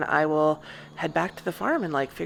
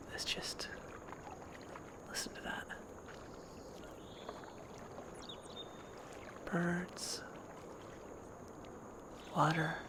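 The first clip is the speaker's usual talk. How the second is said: near-whisper, very soft and spaced out; barely voiced, reverent hush; hushed listening; 18 dB quieter than usual. Real, not acted. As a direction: whisper so the nature sounds come through.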